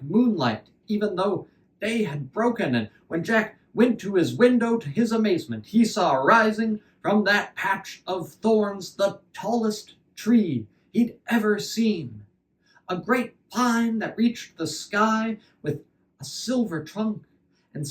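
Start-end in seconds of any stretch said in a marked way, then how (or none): none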